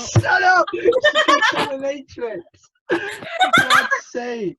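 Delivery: laughing loud and high. Real, not acted. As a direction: burst out laughing in protest. Teased about dominatrix, laughs.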